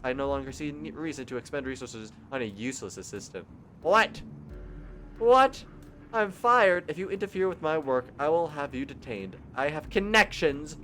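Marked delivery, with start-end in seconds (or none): 3.8-6.8 stunned repetition. Acted. Repeated shock at firing.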